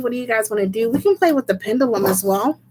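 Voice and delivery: ordinary delivery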